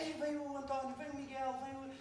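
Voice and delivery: eager high voice; excited. Retold or imitated. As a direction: retell the team's excitement. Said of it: "ficamos excitados".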